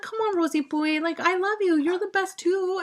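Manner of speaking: put-on high, sweet little girl pleading; cute child voice. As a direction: coax Grandma like a small child. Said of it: "She's like".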